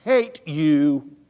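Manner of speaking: loud, drawn-out mock child's outburst; imitating a furious little boy's yell; retold shout. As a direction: stretch each word out loudly like an angry four-year-old. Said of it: "And he shouts".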